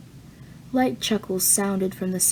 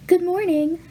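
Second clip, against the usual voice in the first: bright, much higher cheerful hello; enthusiastic greeting; 10 semitones higher, 4 dB louder than usual. A narrator's reading. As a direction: greet the guest with formal enthusiasm. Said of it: "with an enthusiasm and formality".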